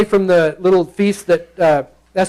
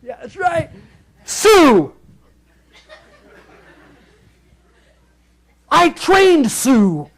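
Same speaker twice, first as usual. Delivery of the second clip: mock bragger; 9 semitones higher, much slower than usual. Mocking someone bragging about trainer.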